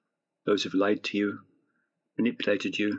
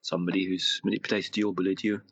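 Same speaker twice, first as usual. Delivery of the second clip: guided meditation; 4 dB quieter than usual. Acted. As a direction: gently guide them into relaxation.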